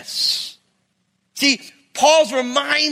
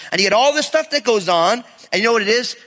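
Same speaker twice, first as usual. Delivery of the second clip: ordinary talk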